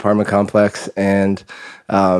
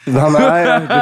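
high, laughing echo; 5 semitones higher, much slower than usual. Real, not acted. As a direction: answer with a delighted laugh.

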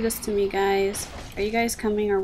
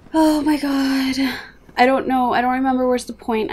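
panicked; 4 semitones higher, 6 dB louder than usual. Real, not acted. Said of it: "I'm, like, freaking out right now".

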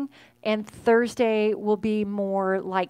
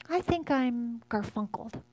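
a light, softer take on her daughter's voice; imitating her oldest; 3 semitones higher, 5 dB quieter than usual. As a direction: gently mimic the little girl saying it. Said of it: "That's exactly how she would say it".

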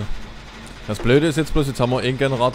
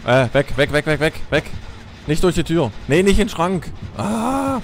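panicked shouting; 6 semitones higher, 4 dB louder, slower than usual. Real, not acted. Panicked shouting at game killer.

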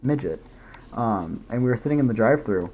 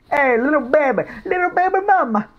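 put-on much higher, louder cooing baby talk; baby talk to himself; 6 dB louder than usual. Streamer sweet-talking himself mid-smooch, high loud cooing. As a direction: smother yourself in sweet talk, pitched way up.

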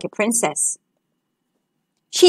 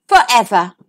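gentle promise; 4 semitones lower than usual. A narrator's reading. Princess answers the frog "forever.", low falling promise. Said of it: "said the princess".

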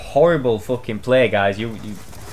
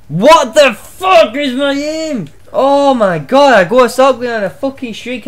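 frustrated outburst; 8 semitones higher, 5 dB louder than usual. Real, not acted. Gamer angry at own aim.

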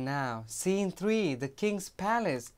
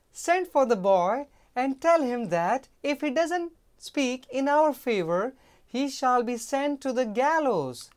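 high, loud royal threat; threatening queen; 7 semitones higher, 6 dB louder than usual. Acted. Queen ordering the boy be threatened with gallows, high and loud. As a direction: order it coldly as a ruler.

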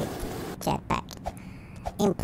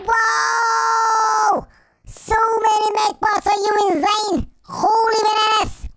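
shocked shout; 8 semitones higher than usual. Real, not acted. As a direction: blurt it out at the sudden horde. Shocked by many mech bots.

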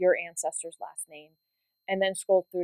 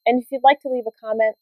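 louder, bright sign-off voice; upbeat outro; 8 dB louder than usual. Acted. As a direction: wrap up the episode for viewers.

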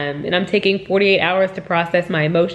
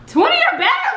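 half laugh, half yell in disbelief; incredulous laughing shout. Real, not acted. To the delivery guys asking for a tip, a laughing incredulous shout.

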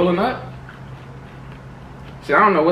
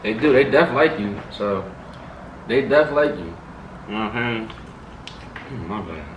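chewing while talking; speaking between bites; mouth full; 3 semitones lower, 3 dB quieter than usual. Real, not acted. Seen 2: Man holds wing, bit it just before, jaw working as he talks.

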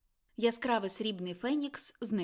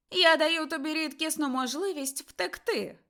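piercing voice; 6 semitones higher, 6 dB louder than usual. A narrator's reading. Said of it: "промовив пронизливий голос".

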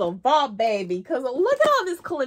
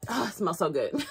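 delighted. Real, not acted. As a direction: savour the scent, laugh. Smelling candle with delight.

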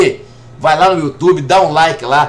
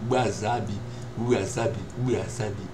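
muttering the name; 10 semitones lower, 13 dB quieter than usual. Real, not acted. Searching, repeating the name to himself.

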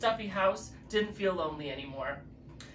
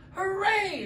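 cheer; 12 semitones higher than usual. Acted. Garden friends cheering the wedding news, high cheer. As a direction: cheer with delight.

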